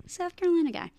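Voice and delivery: playful attempt at a Southern accent; mock Southern accent. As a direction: tease it in a bad Southern accent. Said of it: "I can't do a Southern accent".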